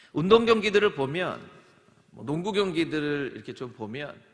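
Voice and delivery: usual speaking voice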